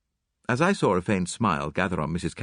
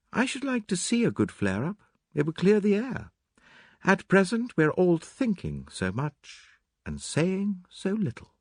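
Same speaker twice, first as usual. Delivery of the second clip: Mary Cavendish; 11 semitones higher than usual. Acted. Mary wishes for a flare-up, composed high voice. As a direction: muse in a high voice, slowing toward the end.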